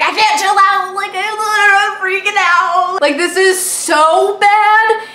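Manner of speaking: high, loud panicky pleading; can't calm down, voice up high; frantic reply to friend telling her to chill; panicked. Retold or imitated.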